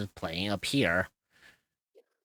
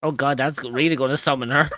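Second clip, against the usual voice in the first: excited; 4 semitones higher, 9 dB louder, faster than usual. Real, not acted. Excited reaction to summoning joke.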